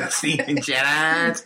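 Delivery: laughing Scouse echo, slow; Liverpool accent. Retold or imitated. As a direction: repeat it Scouse, giggling. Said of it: "Is that your Liverpool accent?".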